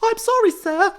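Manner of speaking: put-on very high squeaky girl; high girly voice. Same girl voice.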